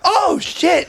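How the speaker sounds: high-pitched startled yelp; retold panic. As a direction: blurt it in mock panic, pitch leaping up. Retelling his panic at lunging eel.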